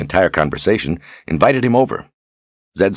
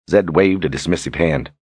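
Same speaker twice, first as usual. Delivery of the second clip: ordinary delivery, nothing marked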